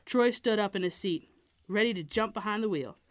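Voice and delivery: ordinary delivery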